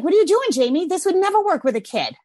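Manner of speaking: re-enacted exasperated self-reproach; self-scolding. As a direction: tell yourself off in disbelief. Acts out scolding herself.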